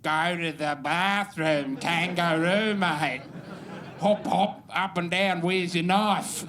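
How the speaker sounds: mock Australian accent. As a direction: ham up an American's fake Aussie. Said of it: "They'd say, like".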